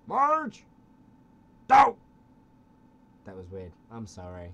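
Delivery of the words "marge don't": shouting. Pretend. Homer shouting at Marge.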